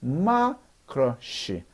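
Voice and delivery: 디마크러시 slow, each syllable split. Shown as a demonstration. Teacher reads 디마크러시 slowly in syllables. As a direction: break 디마크러시 into pieces. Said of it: "나눠서 읽으면 되죠".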